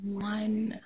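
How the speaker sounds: soft whisper. Real, not acted. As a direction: whisper so nobody wakes. Quiet early greeting while others sleep.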